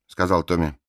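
ordinary delivery, nothing marked

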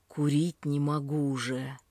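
ordinary delivery

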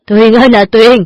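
loud fake wail; father fakes grief over his daughter, loud wail. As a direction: ham up a father's lament. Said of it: "Vừa kêu gào".

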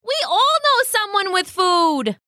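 excited, high-pitched pheasant; sudden bright idea. Acted.

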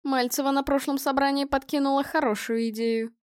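ordinary delivery, nothing marked

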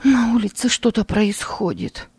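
anxious voice. Acted. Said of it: "от её тревожного голоса".

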